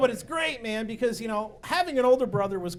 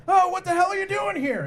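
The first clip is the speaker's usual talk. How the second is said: startled angry yell; 10 semitones higher, 7 dB louder than usual. Retold or imitated. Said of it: "they're like".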